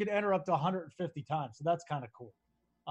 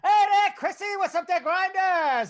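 high, loud sports radio rant; Mad Dog voice; 9 semitones higher, 7 dB louder than usual. Retold or imitated. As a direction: crank it way up like a shouting radio host. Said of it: "my best, um, Mad Dog impression right now".

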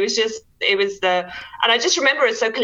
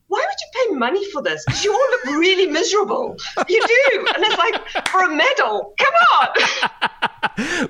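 high, laughing mock protest; mock disbelief; 8 semitones higher than usual. Guest retells teasing friends who paid to race, mock disbelief. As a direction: question their sanity, voice pitched up and amused.

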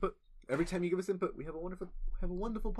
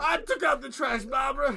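put-on louder, higher grumpy old man; grumpy Bronx husband; 6 semitones higher, 4 dB louder than usual. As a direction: snap back at your nagging wife. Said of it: "old couple arguing in the Bronx".

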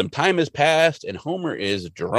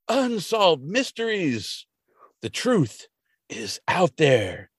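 high, swooping mock-grand speech with pauses; dramatic monologue; 6 semitones higher than usual. Mulder monologuing in a field, mock-dramatic and higher. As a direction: declaim it grandly, stretching the last phrases. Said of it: "he starts to monologue".

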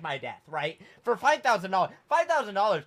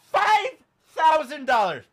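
high, loud, drawn-out outrage; outraged disbelief; 9 semitones higher, 7 dB louder than usual. Retold or imitated. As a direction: stretch each word in loud comic outrage. Acted outrage at the bill.

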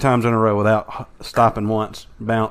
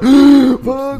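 panicked high yelp; 13 semitones higher, 5 dB louder than usual. Pretend. Choking Goliath acted.

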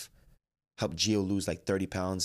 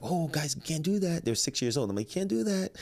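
high sing-song scolding; 9 semitones higher than usual. Retold or imitated. Stopping six-year-olds fighting.

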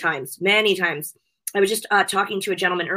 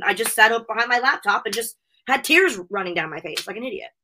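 usual speaking voice

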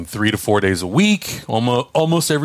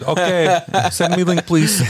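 higher grandma voice in a put-on Russian accent; mock Russian accent; 8 semitones higher, slower than usual. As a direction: play grandma asking for the link with a joking Russian accent. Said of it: "I don't know why I made that accent".

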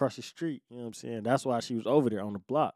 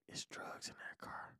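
slow, hushed confession; secretive whisper; 21 dB quieter than usual. Retold or imitated. Sister warns brother near police, secretive whisper. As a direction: imitate a furtive warning, fully whispered.

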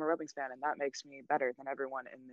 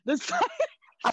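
mock grand pronouncement; 5 dB louder, much slower than usual. Mock trophy name, high drawn out.

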